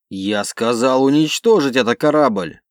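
loud pounding command; furious order. Acted. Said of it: "стучал кулаком".

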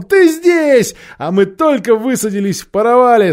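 loud, high-pitched rejoicing; gleeful shouted greeting; jubilant. A narrator's reading. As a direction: burst out with delight at seeing a friend. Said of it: "ликовал он".

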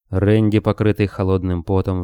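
usual speaking voice

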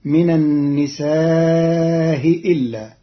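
من النساء إلا drawn slowly with long vowels; slow النساء إلا with eased hamza; lengthened madd. Shown as a demonstration. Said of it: "مع المد".

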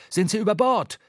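startled. A narrator's reading. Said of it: "aufgeschreckt und wieder hellwach".

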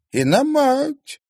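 high, slow crone voice; old crone. A narrator's reading. Old crone to the girl, high and slow. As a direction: croak it out as the old woman.

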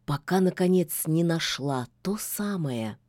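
plain reading voice

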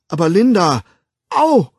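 loud, high protest ending in a yelp; plead, then cry out in pain; schmerzvolles Flehen. A narrator's reading. Child pleads while being slapped. (laut flehen und aufschreien)

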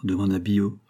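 usual speaking voice

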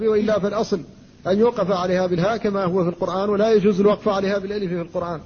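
usual speaking voice

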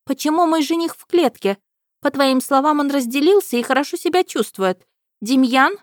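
high, loud demanding princess; indignant princess. A narrator's reading. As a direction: demand answers like a spoiled princess.

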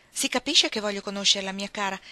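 plain reading voice

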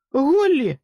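very high, loud cry of a name; anguished shout. A narrator's reading. Jack crying out as Holly is taken. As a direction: cry out after her. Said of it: "вигукнув Джек".

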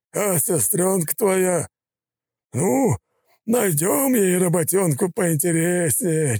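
high, slow, contemptuous gangster; sneering thug voice. A narrator's reading. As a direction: gloat over his victim.

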